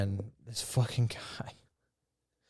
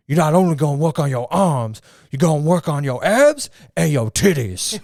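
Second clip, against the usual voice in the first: loud, higher hammed-up gym bro voice; loud mock gym guy; 6 semitones higher, 11 dB louder than usual. Retelling the stranger shouting titties in the gym, mock loud gym bro. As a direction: bellow it like the stranger in the packed gym. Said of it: "he was like".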